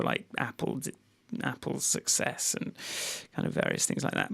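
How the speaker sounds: barely voiced, dropping to a whisper; whispered aside. Real, not acted. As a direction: slip into a hushed whisper. Muttered aside while reading quotes.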